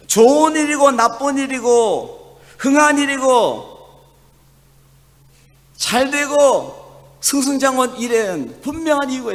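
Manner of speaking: rising pulpit fervor; fervent preaching. Acted. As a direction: proclaim it to the congregation. Preaching at full force.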